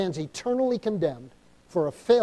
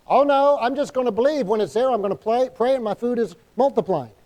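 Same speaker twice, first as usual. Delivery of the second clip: higher, louder opening cry of protest; mock protest; 5 semitones higher, 4 dB louder than usual. Believer refusing to store food before a flood, mock protest. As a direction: imitate a believer brushing off all preparation.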